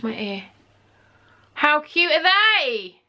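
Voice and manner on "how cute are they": high, slow gushing cry of how cute; excited shout. Real, not acted. Vlogger gushes at her new earrings, a high drawn-out cry. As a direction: belt out the delight, stretching each word. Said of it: "Don't know why I had to shout there".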